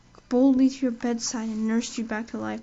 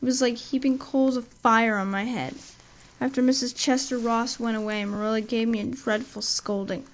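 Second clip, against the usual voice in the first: ordinary delivery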